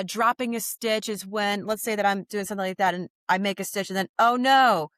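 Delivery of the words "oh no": louder, higher pretend alarm; mock dismay. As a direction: exclaim in playful dismay at a small mishap. Acts out dropping a stitch.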